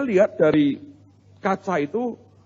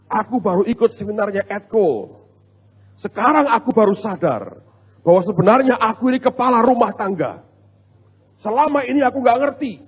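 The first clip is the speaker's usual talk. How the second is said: higher-pitched, emphatic mock proclamation; husband lecturing his wife; 5 semitones higher, 4 dB louder than usual. Husband home from a seminar tells his wife he rules, mock preachy. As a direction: imitate a newly self-appointed boss laying down the law. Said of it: "dia ngotbahi istrinya".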